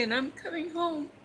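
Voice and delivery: fighting back tears while telling about mom's call; choked up, emotional. Real, not acted. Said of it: "I'm sorry if I get emotional".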